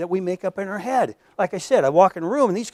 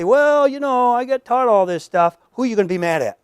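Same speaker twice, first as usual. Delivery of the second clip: higher, louder, defensive excuse; excuse-making abuser; 7 semitones higher, 7 dB louder than usual. Retold or imitated. Abuser excusing himself to the girl's father, defensive. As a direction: shrug it off as if it's not your fault. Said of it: "and I say".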